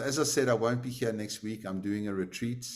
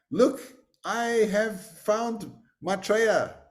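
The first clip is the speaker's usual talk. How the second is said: slow, much higher, louder proclamation; proud announcement; 11 semitones higher, 6 dB louder, much slower than usual. Acted. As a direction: announce the angel to the whole town. Announces the angel to town.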